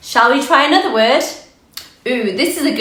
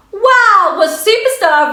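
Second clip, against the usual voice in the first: excited; 9 semitones higher, 3 dB louder than usual. Acted. Praising young readers.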